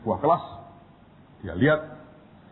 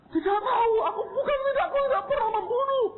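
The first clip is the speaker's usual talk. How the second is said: high, sobbing cry of innocence; crying denial; faster than usual. Retold or imitated. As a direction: wail that you never killed anyone. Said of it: "nangis, nyembah-nyembah". (mahasiswa menangis keras menyangkal membunuh)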